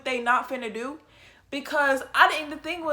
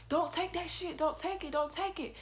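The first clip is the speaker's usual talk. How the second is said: usual speaking voice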